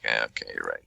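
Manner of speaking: sarcastic giving in. Retold or imitated. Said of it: "you're like".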